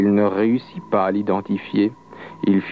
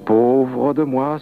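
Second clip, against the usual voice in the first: slow, higher-pitched sigh of self-pity; sighed lament; 4 semitones higher, much slower than usual. A narrator's reading. The beaten devil lamenting to himself, sighing. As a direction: sigh it out slowly, sorry for yourself. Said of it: "soupira le malin".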